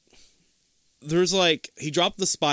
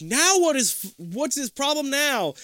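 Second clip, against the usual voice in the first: higher-pitched exasperated complaint; mock fed-up outburst from another room; 12 semitones higher than usual. Acted exasperated sister in retelling.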